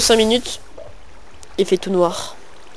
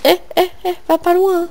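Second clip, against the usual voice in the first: high calling; 8 semitones higher than usual. Pretend. Calling to animal.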